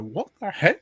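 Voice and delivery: high-pitched mock bewilderment; confused. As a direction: imitate a startled, baffled double take. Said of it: "he'd be so confused".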